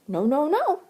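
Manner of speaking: a jittery squirrel shaking it off; chattering squirrel. A narrator's reading. Squirrel answering Jessie, high and jittery. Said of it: "chattered the squirrel".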